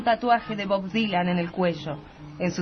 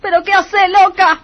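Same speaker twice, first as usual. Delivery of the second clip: shocked outcry; 9 semitones higher, 9 dB louder than usual. Acted. Teresa shocked at Elsa's blow.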